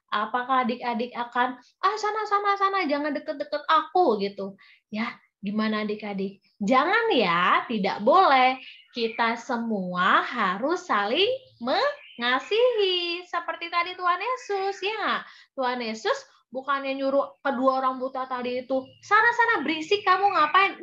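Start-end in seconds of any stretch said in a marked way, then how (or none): 1.8-4.2 high, quick mock rejection; play-acted 'get away from me'; dismissive shooing. Acting a child shooing someone away. 19.1-20.8 high-pitched mock scolding; play-acted annoyed dismissal; irritated shooing. Acting what Jesus would not say.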